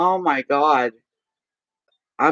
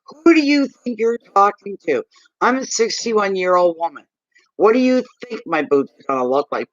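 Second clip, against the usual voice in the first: higher-pitched, incredulous retort; indignant telling-off; 6 semitones higher than usual. Retold or imitated. Woman retelling how she told off a caller, indignant. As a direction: act out scolding him in outraged disbelief.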